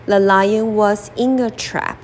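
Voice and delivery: ordinary delivery, nothing marked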